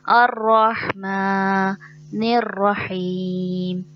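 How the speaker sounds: अर्रहमानिर with मा stretched one alif; slow recitation, hold the मा; अर्रहमानिर रहीम drawn out; correct long a. Shown as a demonstration. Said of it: "एक अलिफ के बराबर खींचते हैं".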